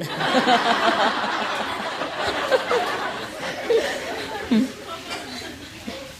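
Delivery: long high real laughter. Real, not acted. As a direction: keep laughing at the punchline. Laughing at own joke.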